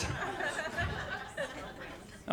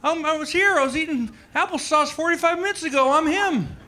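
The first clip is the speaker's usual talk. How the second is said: put-on much higher thin old-man voice; old Biden impression; 14 semitones higher than usual. Mocking Biden's debate reply, much higher and thin. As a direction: squeak it out like a frail old man. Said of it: "And he's like".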